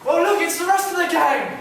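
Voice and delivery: high, loud excited call; calling out with delight; excited announcing. Acted. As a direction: announce the gang with a thrill. Announcing the gang's arrival.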